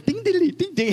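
high plinking ding-ding; quick sing-song instrument noises; keyboard imitation. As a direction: mimic a tinny keyboard riff. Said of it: "that guy with that keyboard".

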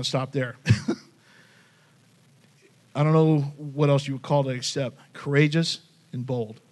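usual speaking voice

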